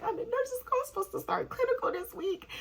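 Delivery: very high, squeaky mock panic; hammed-up tearful panic; freaking out. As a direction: wail it high, imitating a meltdown. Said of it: "I'm freaking out".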